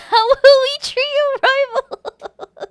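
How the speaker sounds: high, loud, drawn-out words through laughter; laughing wail. Real, not acted. Streamer laments a trio falling out, laughing. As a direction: cry it out while cracking up. Laughing over rivalry in chat.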